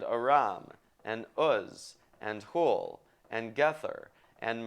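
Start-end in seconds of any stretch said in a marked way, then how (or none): none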